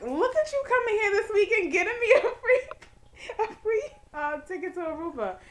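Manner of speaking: high, loud, laughing glee; excited gushing. Retold or imitated. Telling partner about the free ticket, gleeful. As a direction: gush breaking into laughter.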